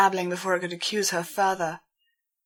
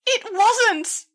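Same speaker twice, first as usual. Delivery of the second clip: much higher, louder cry, voice cracking; voice almost breaking; 6 dB louder than usual. A narrator's reading. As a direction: plead it with the voice breaking. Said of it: "she cried, her voice almost breaking".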